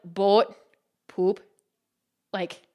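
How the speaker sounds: low Midwestern vowels on "boat" and "poop"; Midwestern accent. Shown as a demonstration. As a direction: show off a typical Midwest accent, word by word. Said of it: "I have a typical Midwestern accent".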